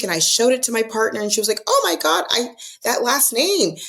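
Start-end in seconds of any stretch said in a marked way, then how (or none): 1.7-3.8 high-pitched astonished gasp of recognition; retold shock, pitched way up; amazed reaction. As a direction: act out a friend suddenly making the connection. Acted partner's amazed reaction to surname.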